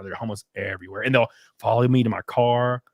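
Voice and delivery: ordinary delivery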